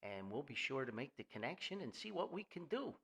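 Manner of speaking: normal talking voice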